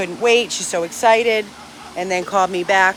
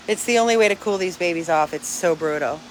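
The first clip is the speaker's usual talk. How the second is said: ordinary delivery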